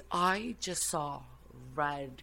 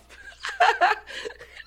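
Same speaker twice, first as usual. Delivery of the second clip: very high, loud burst of laughter; shrieking laugh; 8 dB louder than usual. Real, not acted. Laughing at her own bluff to her friend, high shriek. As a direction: cackle at your own nerve.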